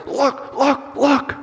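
put-on very high, squeaked toddler voice; high, urgent acted child's calls; excited little child. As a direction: imitate a child bursting with awe. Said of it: "And he's going like".

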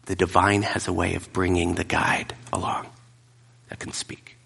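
soft, hushed blessing fading out; whispered prayer. Real, not acted. Pastor blessing his listeners, fading to a whisper. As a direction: close the sermon with a quiet prayer.

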